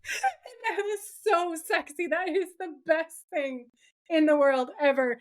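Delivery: high, laughing, near-tearful delight; laughing swoon. Real, not acted. Podcaster gushing to co-host about the hero's move, laughing high. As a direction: giggle through it, overcome. Swooning over the hero scene.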